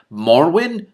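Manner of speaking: loud, high disbelieving cry; can't believe it's her; incredulous. A narrator's reading. Magician spotting his old friend, incredulous. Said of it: "Telemain's incredulous voice".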